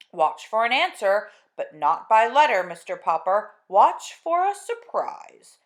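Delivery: slow, loud, distant radio announcement; Admiral Drake on radio. Acted. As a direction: broadcast across the world, slowly.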